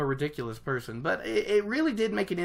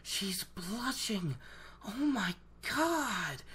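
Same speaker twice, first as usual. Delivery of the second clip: much softer and slow, stunned; hushed disbelief, mild; awed hush; 4 semitones higher, 11 dB quieter, much slower than usual. Retold or imitated. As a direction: breathe it like you can't believe your eyes. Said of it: "and he's like".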